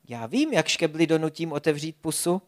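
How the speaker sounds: ordinary delivery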